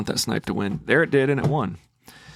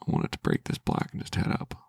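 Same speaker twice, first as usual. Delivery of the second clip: whispered, much lower and softer; whispered wish; 7 dB quieter than usual. Real, not acted. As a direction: breathe the wish like a silent prayer. Repeats the wish under breath.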